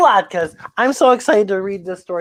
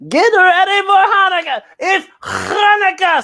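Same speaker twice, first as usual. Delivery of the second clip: grandpa voice; 11 semitones higher, 5 dB louder than usual. Acted. Grandpa announcing the holiday to the family, put-on voice. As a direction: boom it out like a jolly old grandpa. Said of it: "says Grandpa".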